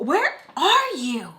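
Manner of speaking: high, loud, drawn-out mock yell; exasperated inner shout. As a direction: stretch it out as a frustrated cry in your head. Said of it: "And in my head".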